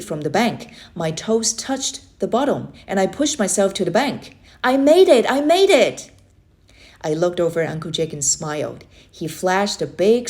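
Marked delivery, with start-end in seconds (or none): 4.6-6.1 high, loud joyful shouts; triumphant cheer. Acted. Boy cheers after swimming across, triumphant shout. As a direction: celebrate like you just won.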